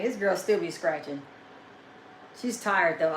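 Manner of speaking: usual speaking voice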